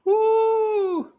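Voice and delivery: high-pitched "woo"; long, high, impressed whoop. Real, not acted. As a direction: whoop at the big reveal. Co-host reacts to big reveal.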